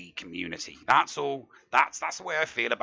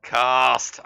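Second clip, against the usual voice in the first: big, loud show-host hello, high on there; announcer opening; 3 semitones higher, 7 dB louder than usual. Real, not acted. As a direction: welcome everyone like a show host. Podcast show opening greeting.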